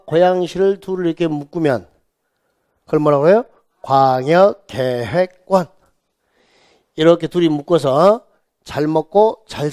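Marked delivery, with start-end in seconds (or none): 3.8-5.7 slow, stretched syllables; measured classroom chant; drilled term. Shown as a demonstration. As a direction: draw it out for the class to repeat. Teacher drills term for repetition.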